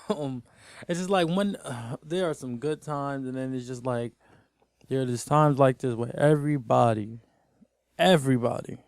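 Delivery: ordinary delivery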